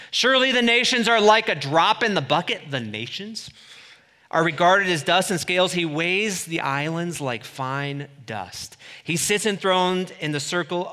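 loud, high, driving sermon build; preaching at full force. Acted. Preacher driving the point home to the church, loud and high. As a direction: hammer it home to the congregation.

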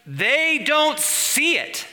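very high, slow, stretched cry; emphatic exclamation. Real, not acted. Preacher on a church blind to its state, high incredulous exclamation. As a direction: drag each word out high in disbelief.

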